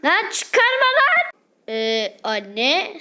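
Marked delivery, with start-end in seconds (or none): 0.1-1.3 pitched way up, slow and whiny; squeaky mock kid; high squeaky protest. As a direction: whine like a little kid. Child protests undressing.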